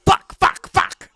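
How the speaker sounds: harsh repeated curse in a put-on voice; driver cursing. Driver stuck below the cut line in his car, cursing impression. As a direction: spit each curse out separately, in character. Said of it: "That's Joey Logano".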